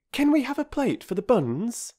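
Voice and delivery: put-on high, quick, abrupt girl; girl interrupting to stop the praise. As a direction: cut her off to change subject. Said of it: "Bobby asked abruptly".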